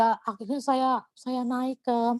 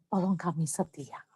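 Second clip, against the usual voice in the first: quiet retold prayer; 7 semitones lower, 6 dB quieter than usual. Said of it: "yang minta sama Tuhan".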